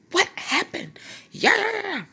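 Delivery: high, hammed-up angry yelling; mock furious outburst dissolving into noise; livid mom. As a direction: imitate a mother going off. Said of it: "she was livid".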